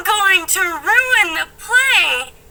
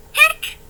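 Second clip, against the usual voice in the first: hiccup; 12 semitones higher than usual. Acted. [name] hiccuping.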